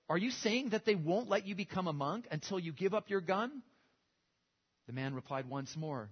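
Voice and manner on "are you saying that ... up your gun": higher, louder disbelieving question; incredulous question. Retold or imitated. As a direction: sound like you can't believe it. Visiting monk questions the gunman.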